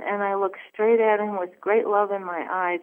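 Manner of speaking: normal talking voice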